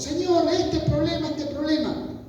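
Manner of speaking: high-pitched, repetitive complaint to the Lord; mock pleading. Imitates our pleading to God. (súplica quejosa imitada, en voz aguda)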